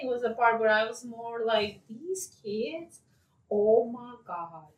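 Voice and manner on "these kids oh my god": slow, drawn-out disbelief, rising at the end; softly dramatized parental exasperation; exasperated quote. Retold or imitated. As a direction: act out being fed up, word by word. Voicing exasperation at the movie's children.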